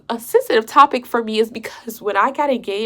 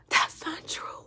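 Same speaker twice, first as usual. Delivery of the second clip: whispered protest; 12 dB quieter, slower than usual. Retold or imitated. Woman denies fiancé's claim, whispered. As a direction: voice drops away to a whisper.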